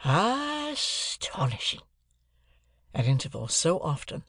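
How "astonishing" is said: very drawn-out, high first syllable; dazed amazement. A narrator's reading. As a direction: stretch it out in stunned wonder. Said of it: "he prolonged his remark".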